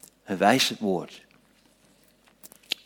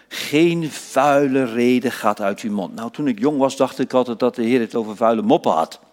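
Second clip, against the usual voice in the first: usual speaking voice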